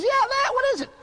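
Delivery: very high-pitched, impatient demand; exasperated questioning. Acted. God asking what the old man holds, exasperated.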